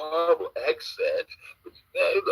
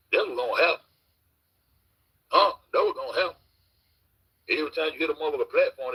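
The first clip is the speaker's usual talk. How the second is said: normal talking voice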